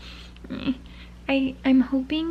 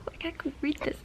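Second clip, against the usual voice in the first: normal talking voice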